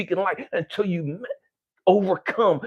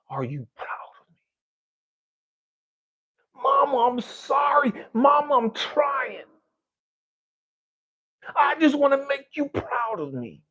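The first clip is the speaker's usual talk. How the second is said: retold crying plea; 8 semitones higher than usual. Son at his mother's grave pleading with her, crying out. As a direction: cry out to mama at her grave. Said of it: "I would just cry and I would just scream".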